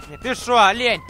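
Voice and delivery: ordinary talk, nothing marked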